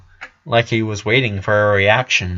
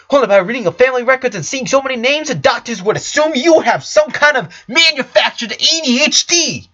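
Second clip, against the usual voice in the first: higher, louder, rapid and sardonic; smug ranting doctor; 11 semitones higher, 4 dB louder than usual. Acted. Doctor mocking Lincoln's diagnosis, higher, louder and fast. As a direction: rattle it off like a cocky physician showing off.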